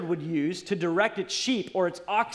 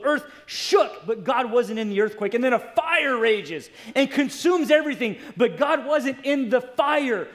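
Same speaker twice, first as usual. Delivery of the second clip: higher, forceful pulpit storytelling; dramatic preaching; 5 semitones higher, 4 dB louder than usual. Real, not acted. Preacher telling of Elijah and the fire, dramatic.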